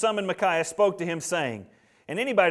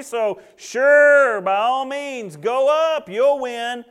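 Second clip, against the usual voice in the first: loud, high-pitched sarcastic agreement; Micaiah; 8 semitones higher, 6 dB louder than usual. Acted. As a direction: humour the king with exaggerated mock enthusiasm. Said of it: "dancing around like all the false prophets".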